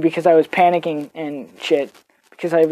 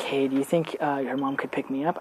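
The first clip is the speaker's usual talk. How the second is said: timid ask; 6 semitones lower, 13 dB quieter than usual. Retold or imitated. Turtle asks timidly.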